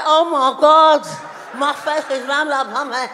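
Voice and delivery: loud gushing outburst, slowing at the end; mock exclamation. Wife repeating husband's remark while walking around, loud exclaiming. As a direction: exclaim it big, then stretch the phrase.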